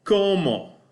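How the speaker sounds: "como" said KOH-moh, high and clear; teacher modeling "como"; model pronunciation. Shown as a demonstration. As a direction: say "como" slowly and crisply for the class. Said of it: "The very first one that you've got here is".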